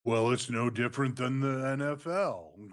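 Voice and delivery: lightly voiced wrestling executive; Triple H. Co-host answering as the boss on a podcast, light impression. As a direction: put on a hint of the boss brushing off fans. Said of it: "Triple H, what you gonna run on a podcast and say".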